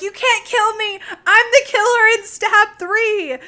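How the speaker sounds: high, loud hammed-up protest; mock screaming plea. Jennifer cornered by the killer, mock screaming protest. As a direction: shriek it in pretend desperation. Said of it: "She's screaming".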